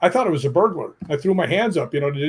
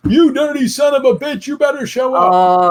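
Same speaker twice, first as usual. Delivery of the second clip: loud, high, hammed-up taunting shout; mock provoking yell; 10 semitones higher, 6 dB louder than usual. Ghost hunter yelling at a spirit, mock angry shout. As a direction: bark it like a ghost hunter picking a fight. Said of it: "You can't go out and say".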